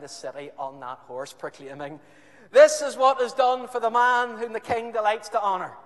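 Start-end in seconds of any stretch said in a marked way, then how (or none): 2.5-5.8 high, loud herald cry; public proclamation. Acted. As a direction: proclaim it to the whole street. Haman proclaiming through streets.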